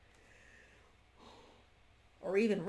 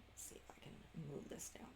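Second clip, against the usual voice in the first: whispered aside; 4 semitones lower, 16 dB quieter, faster than usual. Real, not acted. Aside while adjusting the tablet.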